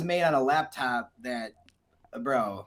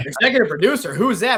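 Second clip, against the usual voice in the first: mock confused; 7 semitones higher, 9 dB louder, faster than usual. Imitating confused person.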